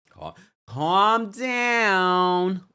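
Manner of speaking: hugely drawn out, high-pitched; exaggerated plea. Real, not acted. Host telling Kenya's fans off, long drawn-out plea.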